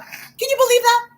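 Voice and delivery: put-on high, squeaky disbelief like an outraged little boy; childish outrage, voice jumping high; indignant kid. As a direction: pitch it way up in mock outrage.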